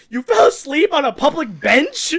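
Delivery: high, loud disbelieving question; incredulous. Retold or imitated. Friend reacting to a nap on a public bench, incredulous. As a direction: climb sharply in mock-outraged disbelief. Retells shocked reply to friend.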